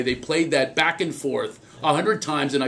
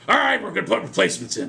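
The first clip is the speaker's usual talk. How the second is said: quick, barking coach voice; gruff coach; 3 semitones higher, faster than usual. Retold or imitated. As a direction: bark it like a tough old coach. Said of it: "he talked like General George Patton".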